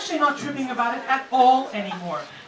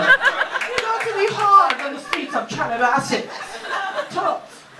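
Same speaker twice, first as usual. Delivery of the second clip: mock tough girl; 6 semitones higher, 4 dB louder than usual. Imitating Gigi, tough chick.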